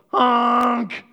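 long loud goose honk; drawn-out mock honk. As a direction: blare out an imitated honk. Imitates the odd hatchling's honk.

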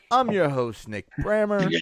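ordinary talk

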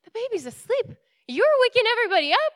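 high protest building to a loud, fast yell; exasperated retort. Retold or imitated. Tenants answering the banging landlady, exasperated and rising to a shout. Retold argument back at the landlady.

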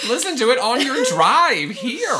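high laughing tease. Real, not acted. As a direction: laugh the words out. Teasing co-host through laughter.

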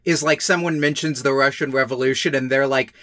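ordinary delivery

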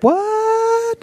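high squeaked gasp of shock; a scandalized queen's What?; high squeak of outrage. Retold or imitated. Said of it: "she's like".

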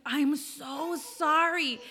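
slow, drawn-out retold apology; apologetic. Mom apologizing for spoiling the surprise, remorseful. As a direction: stretch out the sorry, imitating mom.

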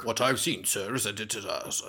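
put-on British commoner; common-folk British accent. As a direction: play a British commoner addressing a sir. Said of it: "with the common folk".

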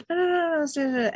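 high-pitched quick insistence; brushing it off. Retold or imitated. Interviewee waving off an offer to postpone, imitated high insistence.

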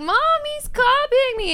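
put-on high whiny kid tattling to mom; whiny tattling little sibling. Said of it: "your typical, like".

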